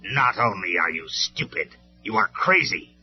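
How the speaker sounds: sneering. Acted. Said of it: "Megatron sneered".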